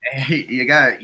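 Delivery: ordinary talk, nothing marked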